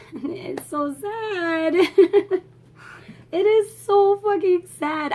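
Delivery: high, teary laughs breaking through; laughing and crying at once over the sad ending. Real, not acted. Laughing while tearing up over deaths.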